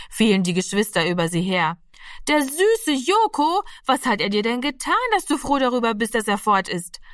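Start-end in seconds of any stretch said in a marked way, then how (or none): 2.3-7.0 indignant outcry. A narrator's reading. Little sister defending the cat to Heidemarie, indignant outcry. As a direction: cry out for the sweet cat. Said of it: "rief Martina".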